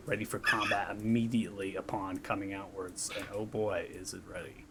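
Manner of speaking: normal talking voice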